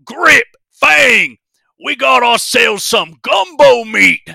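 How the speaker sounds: bugbear shout. Acted. Bugbear shouting to his crocodiles about gumbo meat. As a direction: bellow at the pets like dinner's served. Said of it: "you hear him snarl that".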